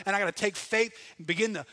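normal talking voice

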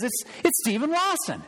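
high-pitched imitation of a Southern accent; Stephen Lawson, strong Southern accent. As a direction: introduce yourself in a big, swooping Southern accent. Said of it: "He's got this strong Southern accent".

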